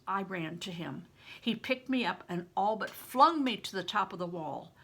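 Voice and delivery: plain reading voice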